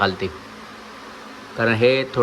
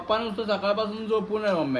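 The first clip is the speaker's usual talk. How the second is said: high-pitched, softer whine; real whiny complaint; 9 semitones higher, 6 dB quieter than usual. Real, not acted. Child complaining to mummy about papa sleeping, whiny. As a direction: whine it up high to win her over.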